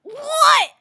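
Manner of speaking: high, loud cry of disbelief; indignant play-yell; mock offence. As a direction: burst out, hammily affronted.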